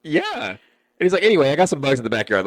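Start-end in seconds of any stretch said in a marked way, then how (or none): 0.0-0.5 high, unsure uh yeah; awkward agreement. Retold or imitated. Salesman awkwardly agreeing with the staring homeowner, high pitched. Awkward reply to strange question. 1.2-2.4 fast, louder brisk switch; quick subject change. Retold or imitated. Homeowner briskly changing subject to the bugs, fast.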